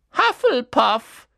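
loud, high, slow hat's cry; calling out. A narrator's reading. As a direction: proclaim the house to the hall. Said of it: "rief der Hut". (laut verkündet vom Hut)

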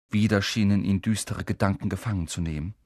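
usual speaking voice

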